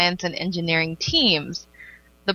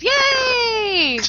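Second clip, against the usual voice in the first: long, high-pitched cheer; excited cheer; 3 dB louder than usual. Real, not acted. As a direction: let out a drawn-out squeal of delight. Cheering the final story.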